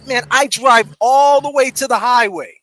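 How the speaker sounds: loud, drawn-out storyteller's exasperation; exasperated. Retold or imitated. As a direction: stretch it out loudly, fed up. Exasperated account of the drive.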